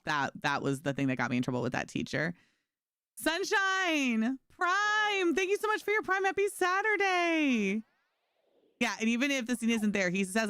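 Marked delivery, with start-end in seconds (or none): 3.2-7.8 much higher, sing-song greeting; high drawn-out happy greeting; bright thank-you. Real, not acted. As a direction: gush thanks to a subscriber. Thanking a stream subscriber.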